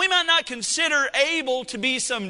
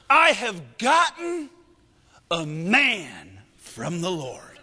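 slow, grand, reverent announcement; Lion King moment; 4 semitones lower, slower than usual. Acted. Eve presenting her newborn like Lion King, slow grandeur. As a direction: hold the baby up and proclaim it slowly. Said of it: "Lion King moment of the Bible".

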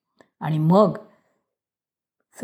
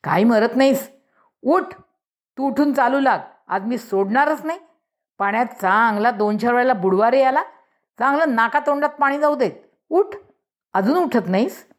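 angry scolding mother; 6 semitones higher than usual. A narrator's reading. Angry mother scolds, orders beating son.

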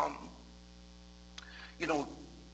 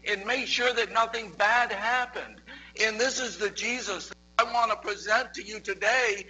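rising, louder preaching with passion; building sermon; 5 semitones higher, 6 dB louder than usual. Acted. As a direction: preach it out with growing fervour. Preaching builds to full force.

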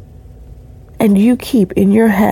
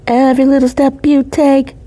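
suddenly louder; 6 semitones higher than usual. Real, not acted. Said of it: "Sorry to do that to your ears".

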